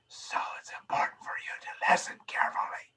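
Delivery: projected whisper, quick and soft; stage whisper. Shown as a demonstration. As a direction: whisper so the whole room still hears. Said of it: "That's whispering".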